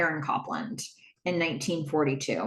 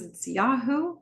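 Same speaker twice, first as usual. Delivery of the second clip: YAHOO lifted at the end like a question; question-mark Yahoo; 4 semitones higher, slower than usual. Retold or imitated. Tripp repeating the name to Donna, questioning tone. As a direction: answer uncertainly, ending YAHOO on a rise. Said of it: "kept saying Yahoo with a question mark".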